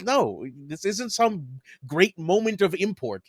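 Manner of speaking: ordinary talk, nothing marked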